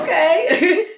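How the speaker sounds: a high, laughing sarcastic okay. Retold or imitated. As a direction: laugh it off in disbelief. Sarcastic reply to his veiled dig.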